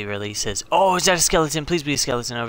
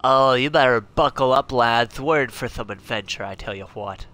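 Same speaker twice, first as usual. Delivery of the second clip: put-on hearty Scottish accent, loud start then easing off; rally the lads in a put-on Scottish accent; 3 dB louder than usual. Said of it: "speak in a Scottish accent".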